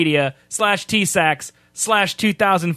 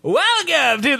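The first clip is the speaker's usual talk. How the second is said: failed announcer voice; 8 semitones higher, slower than usual. Pretend. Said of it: "that's not the same voice".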